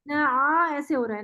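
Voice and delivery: ordinary talk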